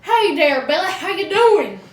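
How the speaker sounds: lightly voiced country drawl; mock Southern greeting. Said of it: "when I talk to my friends, I go".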